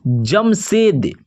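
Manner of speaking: normal talking voice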